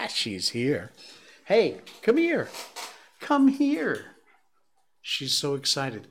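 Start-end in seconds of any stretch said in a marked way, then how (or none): 1.5-4.2 very high coaxing pet voice; dog talk. Real, not acted. Host calls the dog for a cookie, excited high dog talk. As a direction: coax a dog in with a high-pitched call.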